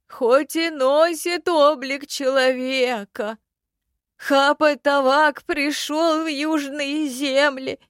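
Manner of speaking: slow, high, hollow ghost voice; skeleton voice. A narrator's reading. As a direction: drag each word like an old corpse. Skeleton tells story.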